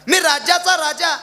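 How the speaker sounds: indignant storyteller's king. Acted. Offended king scolds the woodcutter.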